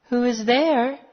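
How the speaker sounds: high storybook grandmother; grandmother calling out. A narrator's reading. Grandmother calling to whoever knocked, high calling voice. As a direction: call out high to the door. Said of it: "called the grandmother".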